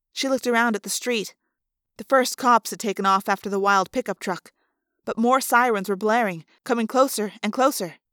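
usual speaking voice

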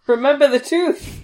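playfully menacing villain quote; ominous villain line. Retold or imitated.

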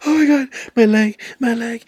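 high-pitched hammed-up wail of pain; mock crying. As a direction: imitate a woman crying out over her leg. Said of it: "she's, like, crying".

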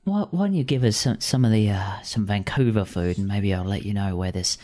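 gruff Cockney bird, teasing; Cockney accent. Acted. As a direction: crow speaking in a Cockney accent. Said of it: "my Cockney accent".

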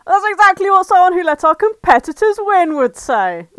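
loud, high-pitched indignant retort; angry shout. Acted. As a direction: shout it angrily at your rival. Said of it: "Mallard shouted angrily".

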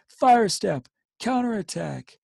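shouted high and loud; officer bawling orders in panic. Acted. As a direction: bawl the order down the trench. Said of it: "gasping and bawling".

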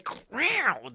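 high squawking raptor call; imitated raptor cry. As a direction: screech like a dinosaur. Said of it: "that sounded like a cat".

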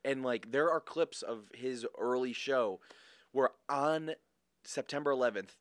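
ordinary talk

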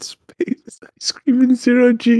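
high, slow mocking echo of his line; mock imitation. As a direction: parrot it back slowly in a silly high voice. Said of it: "He said".

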